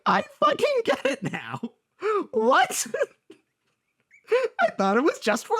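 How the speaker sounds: very high, cracking wail; half-laughing cry. Real, not acted. Heartbroken vlogger admitting she's [name], comic cry. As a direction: break into a comic sob.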